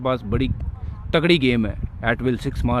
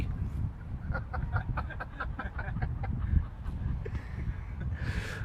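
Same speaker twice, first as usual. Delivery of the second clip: airy, high laughter; breathy laugh; 11 semitones higher, 13 dB quieter than usual. Real, not acted. Laughs at his own joke.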